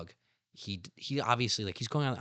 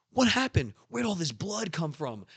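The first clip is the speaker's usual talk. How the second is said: alarmed Sanji; 7 semitones higher than usual. Retold or imitated. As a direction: burst out in alarm at the blood. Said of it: "And Sanji's like".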